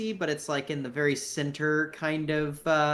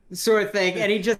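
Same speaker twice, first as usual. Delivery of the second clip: ordinary delivery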